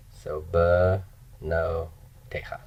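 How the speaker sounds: banotecha slowed down, with the first vowel reduced to a quick b; slow careful. Shown as a demonstration. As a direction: stretch banotecha out so the ba shrinks to a bare b. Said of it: "open proprotonic syllables reduce".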